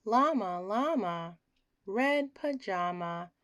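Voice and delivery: usual speaking voice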